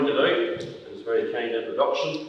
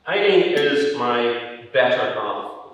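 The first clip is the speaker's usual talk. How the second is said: better said louder and slower, leaning on the word better; stress on better; 2 semitones higher, 7 dB louder, slower than usual. Real, not acted. Introducing his wife to the audience, stress on better. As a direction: lean hard on better as a fond joke. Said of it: "with emphasis on the word better".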